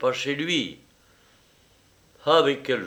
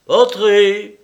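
repeated loud, high call; raised, insistent summons; 9 semitones higher, 12 dB louder than usual. A narrator's reading. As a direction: shout it through the door. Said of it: "Répéta la voix".

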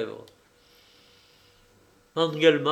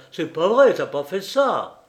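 angry denial; 4 semitones higher, 6 dB louder than usual. A narrator's reading. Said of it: "s'écria Engelmann".

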